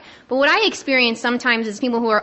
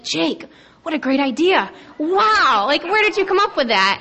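hammed-up gushing, higher on the wow; mock admiration; 4 semitones higher than usual. Members to her guy friend at the business meeting, mock admiration. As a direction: imitate fawning over someone's great idea.

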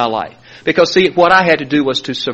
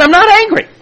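high, loud protest; high defensive denial; 5 dB louder than usual. Retold or imitated. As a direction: insist you're not angry. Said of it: "I said".

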